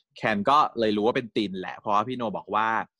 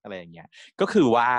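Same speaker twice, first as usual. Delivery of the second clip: ordinary talk, nothing marked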